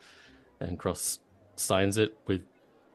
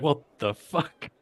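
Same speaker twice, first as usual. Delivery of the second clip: shocked; 4 semitones higher than usual. Retold or imitated.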